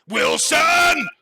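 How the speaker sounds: high, booming shout; booming lord. Acted. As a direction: bellow the name like a commanding officer. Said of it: "booms Laurent".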